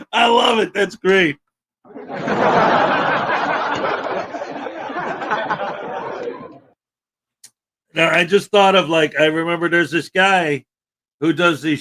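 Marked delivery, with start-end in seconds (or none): 1.9-6.5 long laugh. Real, not acted. Streamer keeps laughing at joke.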